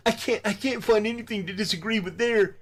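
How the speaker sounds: high-pitched mocking imitation of a giggler; mock giggling schoolgirl. Critic imitating a rival who laughs like a schoolgirl, mocking. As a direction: imitate him tittering in a silly high voice. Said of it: "he's laughing like he's a schoolgirl".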